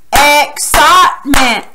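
"Excitement" slow and loud, in three separate beats; syllable by syllable. Shown as a demonstration. Teacher leading claps, "Excitement" syllable by syllable. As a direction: punch out each syllable of "Excitement". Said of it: "don't clap too fast".

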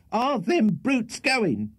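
very high, slow screamed question; shrill scream. A narrator's reading. Landlady asking if the guests are leaving, screaming. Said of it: "screamed the shrill voice".

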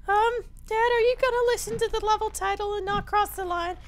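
put-on very high falsetto kid; little son voice. Son asking dad about the level title, squeaky kid voice. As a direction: squeak it as a questioning child.

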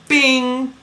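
a sharp, high-pitched cartoon bing; ping it out like a bell being struck; a bright little ding as the flower lands; high bing sound effect. Mimics flower hitting the letter.

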